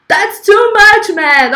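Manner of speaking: high, slow reveal with a laugh; excited winner reveal. Real, not acted. Host revealing the winner to her chat, slow and thrilled. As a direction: announce the winner like an awards host. Announcing award winner dramatically.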